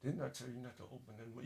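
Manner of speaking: low words squeezed through clenched teeth; through gritted teeth. Retold or imitated. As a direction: hiss it through tight teeth. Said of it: "speak through their teeth".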